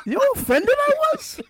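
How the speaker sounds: talking through big laughter; laughing high, barely getting it out. Real, not acted. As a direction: squeals of laughter while asking. Laughing at own story.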